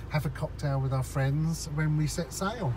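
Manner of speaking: usual speaking voice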